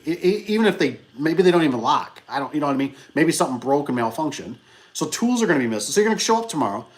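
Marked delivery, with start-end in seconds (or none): none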